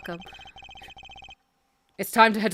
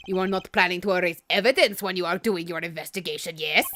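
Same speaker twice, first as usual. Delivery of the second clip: put-on low, accented questioning; suspicious foreign witness; 5 semitones lower, 4 dB quieter than usual. Witness doubting the suspect's investigation, suspicious accent.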